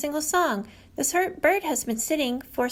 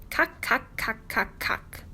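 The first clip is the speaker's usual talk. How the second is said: sharp clucked "cock, cock" fading softer; woodpecker call; 2 semitones lower, 4 dB quieter, slower than usual. Retold or imitated. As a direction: knock it out like a woodpecker, then fade. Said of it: "Woodpecker calls from a tree".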